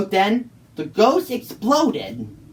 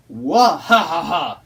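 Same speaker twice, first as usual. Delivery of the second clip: booming villain cackle, louder; evil ghost laugh; 3 semitones lower, 4 dB louder than usual. Acted. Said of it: "said the ghost".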